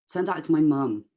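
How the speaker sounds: plain reading voice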